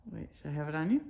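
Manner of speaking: usual speaking voice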